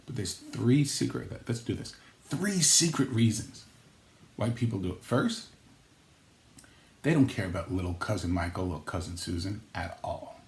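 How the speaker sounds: usual speaking voice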